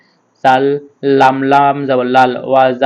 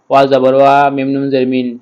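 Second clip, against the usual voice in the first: ordinary talk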